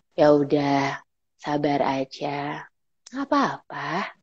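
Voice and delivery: low, soft, slow and rounded; wise elder. Shown as a demonstration. Elder telling someone to be patient, calm wise tone. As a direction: soothe them slowly like a wise elder. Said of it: "Wise elder. Tonasi yang bulat".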